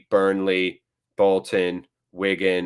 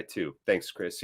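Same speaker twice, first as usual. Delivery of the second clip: ordinary talk, nothing marked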